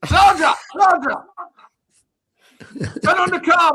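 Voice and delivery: calling out. Acted. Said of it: "Harry Redknapp, you smashed it".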